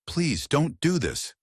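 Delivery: high, slow pleading; desperate begging. A narrator's reading. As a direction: beg him, desperately. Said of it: "she begged, her voice raw with desperation".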